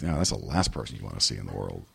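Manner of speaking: low, soft, fast grumble; inner grumble. Retold or imitated. The private thought about the cousin, low and fast. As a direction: mutter the real feeling quickly. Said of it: "but really it's like".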